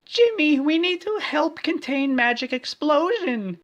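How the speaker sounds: very high, loud call at the door; cried. Acted. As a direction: shriek for his help. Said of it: "she cried".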